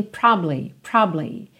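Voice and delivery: "probably" in two syllables, PROB-lee; middle syllable dropped. Shown as a demonstration. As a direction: squeeze "probably" down to "probly". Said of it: "ela realmente é omitida".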